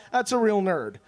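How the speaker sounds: usual speaking voice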